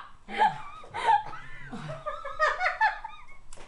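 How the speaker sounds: a long, high, helpless laugh through the burn; pained high laugh. Real, not acted. Burning mouth on camera, pained high laughter. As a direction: laugh it out when the heat hits.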